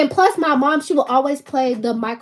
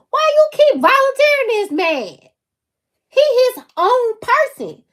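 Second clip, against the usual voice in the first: indignant; 11 semitones higher, 4 dB louder than usual. Real, not acted. Indignant about dad pushing Michael.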